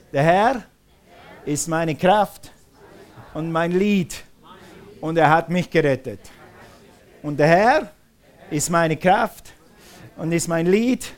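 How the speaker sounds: loud, phrase-by-phrase proclamation with pauses; loud declaration. Real, not acted. Preacher leads congregation saying God is their strength, loud proclamation. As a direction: call it out for a crowd to repeat.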